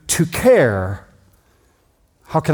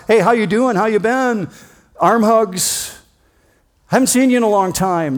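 higher, louder cheery hello; friendly greeting; 8 semitones higher, 3 dB louder than usual. Retold or imitated. Preacher acts a guest greeting a host, high and cheery. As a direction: greet an old friend warmly and brightly.

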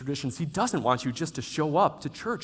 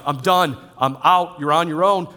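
mild bitter dismissal; 3 semitones higher, 9 dB louder than usual. Pretend. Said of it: "not an embittered God who's just like".

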